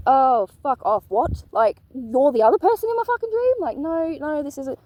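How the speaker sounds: high, loud shocked outburst; shocked disbelief. Retold or imitated. To the twin flame's best friend, loud shocked disbelief. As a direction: blurt it out in stunned disbelief, high.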